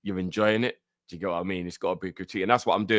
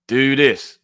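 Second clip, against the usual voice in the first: loud, drawn-out rallying call; hyped shout; 6 semitones higher, 11 dB louder than usual. Real, not acted. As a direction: stretch it out, loud and higher, to kick things off. Hyping up before playing trailer.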